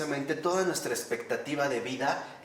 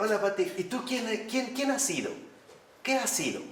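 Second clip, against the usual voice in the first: mock greeting; 8 semitones higher than usual. Priest acts out odd greeting.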